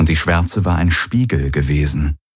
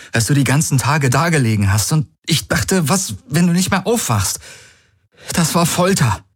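desperate; 9 semitones higher than usual. Acted. Man recalling his vigil at her bedside, desperate. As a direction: let the pitch climb as anguish builds. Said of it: "verzweifelten Ausdruck".

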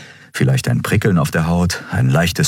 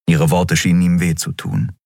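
ordinary delivery, nothing marked

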